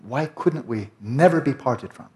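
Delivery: slow pleading question. Shown as a demonstration. Teacher recites the liturgy's question, slow and lifted. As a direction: lift the question slowly, pleading. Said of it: "this question".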